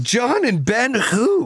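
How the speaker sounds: slow, high, smug 'who'; mock dismissive. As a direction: act like you've never heard of them. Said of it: "we'll be like".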